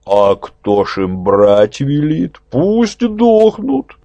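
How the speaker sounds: slow, scornful elder, jumping high; callous old man. A narrator's reading. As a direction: sneer it out, old and heartless. Greedy elder voiced in story.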